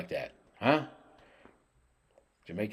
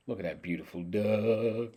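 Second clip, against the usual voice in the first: ordinary talk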